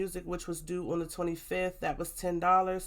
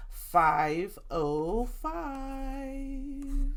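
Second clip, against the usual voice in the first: high, very slow drawn-out singsong; 8 semitones higher, much slower than usual. Pretend. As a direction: stretch the total out in a high singsong. Drawn-out singsong budget total.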